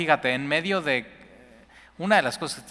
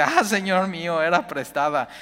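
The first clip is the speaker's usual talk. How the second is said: a touch of a louder, higher lament; half-cry over the lost axe; mild cry of dismay; 5 semitones higher, 4 dB louder than usual. Acted. Woodcutter telling the prophet the axe was borrowed, mild cry. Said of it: "y dice".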